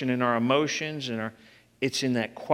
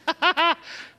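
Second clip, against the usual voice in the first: high laugh; 14 semitones higher than usual. Real, not acted. Laughs at own joke.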